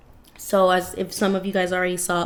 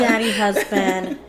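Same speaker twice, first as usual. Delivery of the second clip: laughing; 4 dB louder, slower than usual. Real, not acted. Joking about crush on Bumstead.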